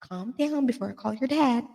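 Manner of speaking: soft, restrained mock-mom warning. Mom quietly warning little Billy, hushed imitation. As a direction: play a mother keeping her voice down while scolding.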